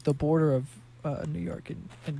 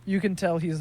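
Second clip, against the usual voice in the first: ordinary delivery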